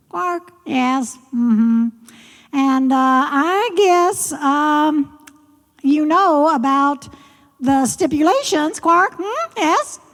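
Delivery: high, drawn-out frog voice; high frog voice with questioning hums; coy frog character. Acted. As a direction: play a frog coyly testing the visitor.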